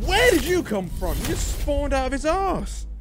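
very high-pitched, startled protest; shocked disbelief. Real, not acted. Player jumped by a spawning enemy, high shocked outcry. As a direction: squeal it out in disbelief.

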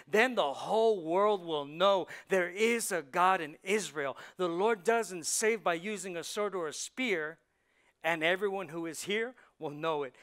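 loud, ringing proclamation; David's defiance. Acted. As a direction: declare it to the whole army.

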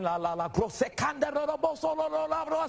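high, loose torrent of prayer syllables; reenacted outpouring of tongues; imitated praying in tongues. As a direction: let nonsense syllables burst out and climb. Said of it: "one night I was praying".

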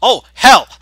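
high loud startled cry; excited shout. Real, not acted. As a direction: burst out in surprise. Finds diamonds while mining.

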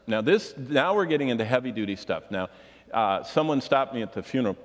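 usual speaking voice

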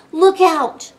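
high, loud shouted alarm; storybook child yelling a warning; warning cry. A narrator's reading. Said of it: "cried a girl".

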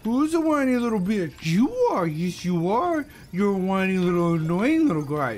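high-pitched, singsong taunting coo; mocking baby talk. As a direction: talk down to a whiny baby in mock sweetness. Mock cooing at whining baby.

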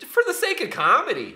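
loud, high, dramatic proclamation; emphatic declaration. Acted. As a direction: declare it grandly. Repeats line with emphasis.

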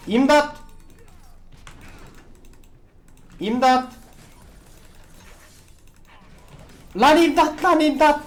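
loud, high cries for help; desperate loud call for help; shouting for help. Real, not acted. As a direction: yell for help at teammates. Calling for help in game.

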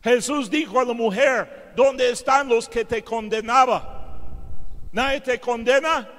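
loud, driving sermon delivery, pushing every phrase; preaching at full force. Acted. Preacher retelling the adulteress story to the church, loud and driving. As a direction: preach it out to the whole congregation.

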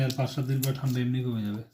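ordinary talk, nothing marked

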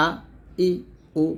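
"আ", "ই", "উ" as separate short vowels, firm glottal onset; firm throat hamza. Shown as a demonstration. Teacher repeating model vowels for learners, firm and separate. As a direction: isolate "আ", "ই", "উ", each started hard in the throat. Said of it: "এরকম".

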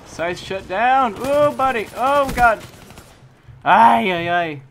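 loud high frustrated outburst; yelling in gamer rage; raging gamer. Real, not acted. Own scythe shut down, raging.

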